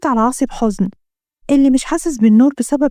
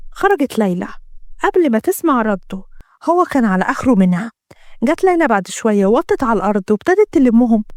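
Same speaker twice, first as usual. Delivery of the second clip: usual speaking voice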